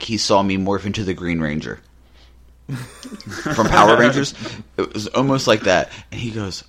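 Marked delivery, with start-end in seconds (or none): none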